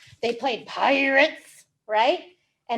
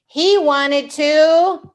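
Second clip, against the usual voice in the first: drawn out, louder build-up; slow suspense; 2 semitones higher, 6 dB louder, much slower than usual. Acted. Reader building suspense before the monster's wish. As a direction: stretch it to tease the listener.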